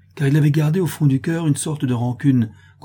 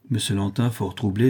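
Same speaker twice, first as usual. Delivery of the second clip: ordinary delivery, nothing marked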